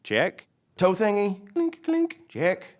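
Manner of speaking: high, put-on tow-truck voice; Mater. Mater does his self-check after lightning, high character voice. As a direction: run a checklist aloud in character. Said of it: "And Mater says".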